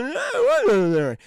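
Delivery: loud, fast, garbled hero bellow; Sylvester Stallone. Retold or imitated. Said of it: "I'll translate Stallone's".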